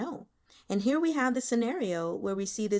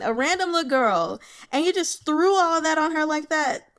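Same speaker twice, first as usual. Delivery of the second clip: exasperated rant; 7 semitones higher, 5 dB louder than usual. Real, not acted. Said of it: "ranting about his bluntness".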